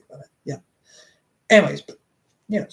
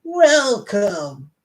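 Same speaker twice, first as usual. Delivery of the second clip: higher, drawn-out theatrical greeting; hammed-up welcome; 4 semitones higher, 4 dB louder than usual. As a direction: give the word a showy flourish. Acted one-word quote in reading.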